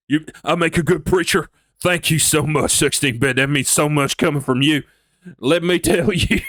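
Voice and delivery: Texan preacher voice. Acted. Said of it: "I really just wanna do the voice".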